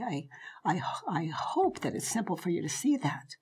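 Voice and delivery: soft unvoiced whisper; near-whispered closing thought; whispered aside. Real, not acted. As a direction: hush it almost to a whisper.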